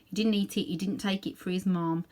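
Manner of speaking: usual speaking voice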